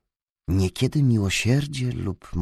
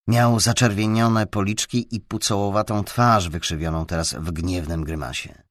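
plain reading voice

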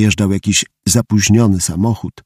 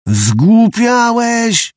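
very high, very slow, loud shout; Mały yells at Łysy crawling under the car; yelled; 12 semitones higher, 4 dB louder, much slower than usual. Acted. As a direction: stop him with a shout. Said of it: "wrzasnął".

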